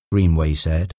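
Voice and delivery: ordinary delivery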